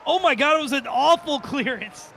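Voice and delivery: high, incredulous reaction to a blunder; excited dismay. Real, not acted. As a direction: exclaim over a terrible mistake live. Reacting live to goal from bad clearance.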